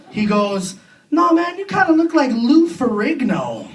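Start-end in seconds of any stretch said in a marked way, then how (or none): 1.1-3.7 bar customer. Acted. Bar customer guessing celebrity lookalike.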